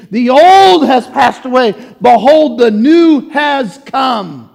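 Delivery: loud, slow proclamation; full-force preaching. Acted. Pastor reading scripture to congregation, preaching loudly. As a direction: thunder it out from the pulpit.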